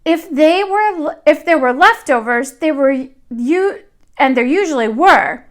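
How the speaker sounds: put-on lively read-aloud voice for young children; storybook reading. A narrator's reading. Teacher reading a picture book to her class, lively storytelling. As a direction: tell the tale to the class as if they sit on the rug.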